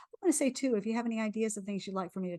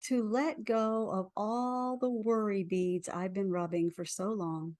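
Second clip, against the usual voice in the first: guided affirmation. Acted. Leads a tapping affirmation.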